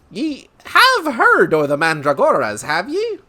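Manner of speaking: loud, lilting Irish-style brogue, swooping up; Lucky Charms leprechaun brogue. Acted. Merchant asking about the Mandragoras, leprechaun brogue. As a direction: ask it like a cartoon leprechaun's brogue. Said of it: "Sounds like the Lucky Charms guy".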